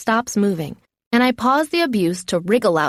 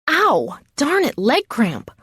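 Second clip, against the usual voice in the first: high, drawn-out cry of pretend pain; feigned pain; 7 semitones higher, slower than usual. A narrator's reading. Faking a leg cramp to fool the attacker, feigned pain. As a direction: play up a sudden cramp. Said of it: "I pretend to have difficulty".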